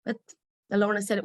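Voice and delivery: usual speaking voice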